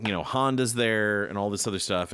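usual speaking voice

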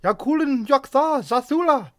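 high, loud shrieking bird cry; harsh parrot screech. Acted. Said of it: "cried out harshly".

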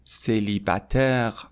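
Célibataire voiced, slow, falling; slow model word. Shown as a demonstration. Teacher repeating the word aloud for students, slow. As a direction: say Célibataire slowly with a falling end.

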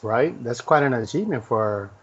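usual speaking voice

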